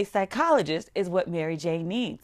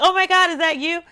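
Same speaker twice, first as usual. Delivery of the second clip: high and loud, mock-thrilled fan; excited fan gasp; 10 semitones higher, 7 dB louder than usual. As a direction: squeal it like a fan who just spotted you. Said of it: "people are like".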